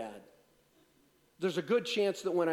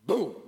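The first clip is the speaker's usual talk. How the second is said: loud sudden "boom!"; storyteller acting out the mower exploding; mock explosion; 7 dB louder than usual. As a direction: blurt the bang like the engine blowing. Lawnmower blows up in story.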